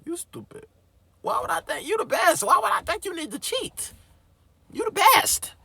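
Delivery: mock scolding shout. Teacher's words retold as a loud scolding yell. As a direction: yell at the student who snitched on himself. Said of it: "He said".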